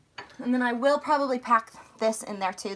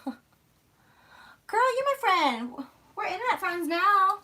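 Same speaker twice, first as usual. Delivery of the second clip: gushing; 8 semitones higher, 4 dB louder than usual. Real, not acted. Gushing to a fellow vlogger.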